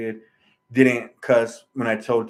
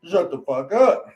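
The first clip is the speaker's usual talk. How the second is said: higher, slower imitation of a snapping doctor; mock snap, raised pitch, measured pace; retold angry snap; 6 semitones higher, 3 dB louder, slower than usual. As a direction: act out his curt outburst, drawn out, pitch raised. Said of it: "he just snaps on me".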